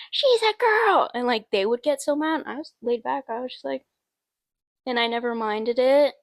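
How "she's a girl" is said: higher and louder, shocked classmates insisting; mock outraged shout. Classmates correcting her pronouns, mock outraged shout. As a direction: yell it like a scandal. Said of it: "people that knew me were like".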